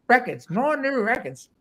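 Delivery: put-on high, playful New York accent; imitating a New York accent; affectionate New York accent impression; Michael Fremer New York accent. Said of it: "in his, in his New York accent".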